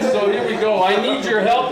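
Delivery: ordinary talk, nothing marked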